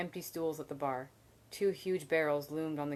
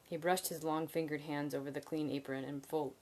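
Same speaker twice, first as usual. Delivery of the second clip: ordinary delivery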